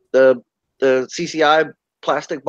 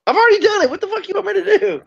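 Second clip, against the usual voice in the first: much higher, mock exasperated; exasperated retort; 14 semitones higher, faster than usual. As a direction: throw up your hands in a higher voice. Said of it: "And I was like".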